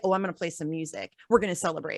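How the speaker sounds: breezy, carefree parody; mock cheery politician. Mocking Cori Bush playing music on the steps, breezy.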